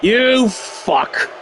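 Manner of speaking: ordinary talk, nothing marked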